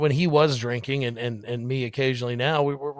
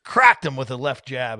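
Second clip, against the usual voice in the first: usual speaking voice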